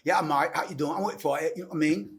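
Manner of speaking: imitated Australian greeting; Australian accent. Shown as a demonstration. Showing the host how Australians greet, imitated Australian accent. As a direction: put on a casual Australian accent. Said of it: "na Austrália".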